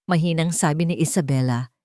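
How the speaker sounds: ordinary delivery, nothing marked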